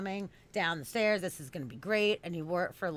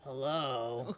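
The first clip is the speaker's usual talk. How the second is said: low, quiet, menacing hello; scary man voice; 8 semitones lower, 6 dB quieter than usual. Retold or imitated. Imitating the stranger's deep voice answering her, low and ominous. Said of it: "I heard the scary voice".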